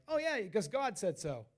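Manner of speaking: mock breezy certainty. Preacher imitates easy acceptance of a move, mock-breezy. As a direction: toss it off as if it settles everything. Mimics breezy faith in retold reaction.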